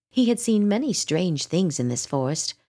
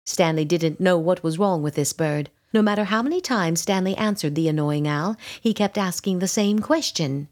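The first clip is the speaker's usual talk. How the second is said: usual speaking voice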